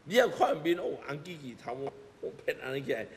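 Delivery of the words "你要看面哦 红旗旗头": usual speaking voice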